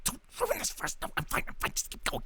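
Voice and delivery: hushed, urgent whisper; frantic whispering. Acted. Cloaked figures fretting among themselves, frantic whispering. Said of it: "frantic sort of whispering amongst them".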